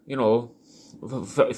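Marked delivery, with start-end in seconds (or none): none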